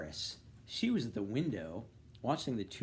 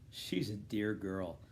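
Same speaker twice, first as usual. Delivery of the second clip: plain reading voice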